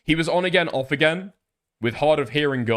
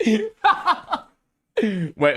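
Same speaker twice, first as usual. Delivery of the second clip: laughing through the word; 9 semitones higher than usual. Real, not acted. Laughing while reading.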